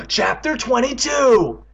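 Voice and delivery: high, slow, loud announcement; shouted chapter title. Acted. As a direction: bellow the heading slowly, high-pitched. Chapter title shouted mid-scene.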